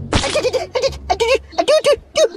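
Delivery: very high squeaky cries of pain; squeaky pained creature. Acted. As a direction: yelp like a hurt little puppet. Pot kesakitan, suara dibuat-buat. (jeritan cicit tinggi kesakitan)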